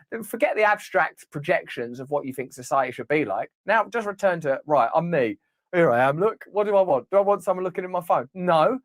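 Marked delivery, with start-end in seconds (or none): none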